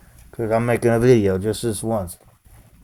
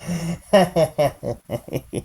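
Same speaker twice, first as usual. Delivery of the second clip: real laughter; 5 semitones higher, 4 dB quieter than usual. Real, not acted. Laugh burst.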